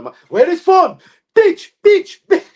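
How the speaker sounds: high, loud, repeated insult; mock furious screaming. Hunting for the phone in a row, mock screaming. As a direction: scream each word in hammed-up rage. Acts out furious family quarrel.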